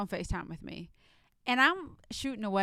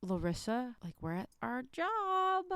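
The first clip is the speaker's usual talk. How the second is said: a much higher, slow mock whine of a coworker; imitating Rachel; 4 semitones higher, 3 dB quieter, slower than usual. Coworker reminding her they are at work, mock-whiny. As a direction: lightly mimic her in a higher, drawn-out plea. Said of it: "Rachel's like".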